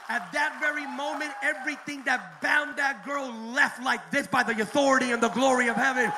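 loud, high, shouted sermon climax; bellowing the name of Jesus to the congregation; full-force preaching. Acted. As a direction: preach it to the rafters, hammering every line.

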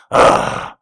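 usual speaking voice

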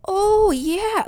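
high put-on polite chirp; fake enthusiastic agreement. Job candidate playing along with interviewer, falsely keen. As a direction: agree eagerly in a fake work voice. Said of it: "in addition to lying".

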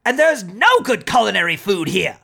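high, ranting celebrity chef; Gordon Ramsay. Acted. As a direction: scold like a furious TV chef. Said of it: "do you want me to do a Gordon Ramsay for you".

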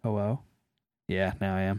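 faint, distant bad connection; call dropping out. Real, not acted. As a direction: voice fading on a weak line. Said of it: "we're having a problem".

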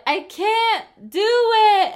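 put-on high, slow childish whine; drawn-out little-kid whine; whiny kid voice. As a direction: whine it like a small child giving up. Whining friend voiced in story.

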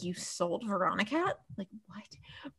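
hushed, breathless disbelief, dropping to a whisper; stunned whisper. Retold or imitated. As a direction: whisper it as if you can't believe the news. Brett stunned the cat was sold.

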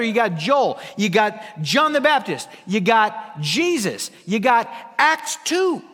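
hammering each point home from the pulpit; fervent preaching. Acted. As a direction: preach it with rising fire. Preacher builds to emphatic lists.

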